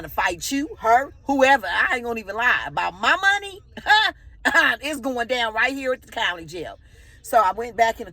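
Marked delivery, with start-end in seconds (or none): 3.6-4.8 high laugh. Real, not acted. Laughing about the money fight.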